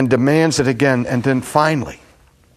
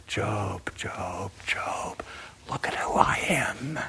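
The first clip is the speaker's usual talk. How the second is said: softer whispered call of God; tender whisper; 11 dB quieter, slower than usual. Acted. God tenderly calling Job to trust him, whispered. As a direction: whisper his name tenderly, inviting trust.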